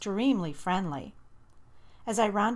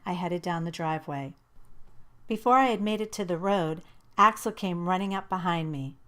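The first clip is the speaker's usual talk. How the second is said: ordinary delivery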